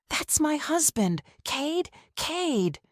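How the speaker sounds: high, pleading call of his name; desperate cry. Acted. Wife crying out to the officer about her husband, high and desperate. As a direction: cry out as if your world just broke.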